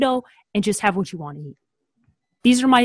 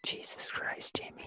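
stunned unvoiced whisper; 24 dB quieter than usual. Real, not acted. Cohost reacting to a hot take in a whisper. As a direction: breathe it out in disbelief.

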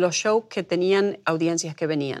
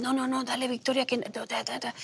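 higher, softer coaxing voice of kind coworkers; 5 semitones higher, 9 dB quieter than usual. Retold or imitated. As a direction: gently insist she take the food. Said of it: "Me la traían y decían".

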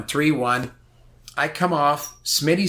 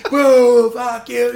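higher, louder mock jeer; imitating crowd yelling; 8 semitones higher, 7 dB louder, much slower than usual. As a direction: yell like a booing crowd. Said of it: "The crowd is screaming at me".

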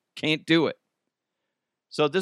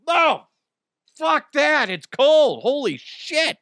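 yelped high and loud; cold shock; 13 semitones higher, 6 dB louder, slower than usual. Retold or imitated. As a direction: yell at the freezing water. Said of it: "I'm like".

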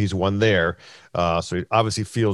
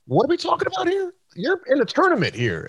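much higher, quicker incredulous protest; retold incredulity. Teller quoting his protest to a pro mid-tournament, incredulous. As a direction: pitch leaps up, baffled at him.

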